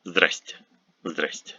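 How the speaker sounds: здрасте squeezed into two syllables, "zdraste"; colloquial short form. Shown as a demonstration. Teacher showing what many people say, clipped casual здрасте. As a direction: say здрасте with half the letters gone. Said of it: "many, many people, uh, pronounce it as".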